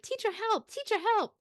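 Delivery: put-on high little kid calling out; kid calling for help. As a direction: pipe up like a small boy begging for help. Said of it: "and he's like".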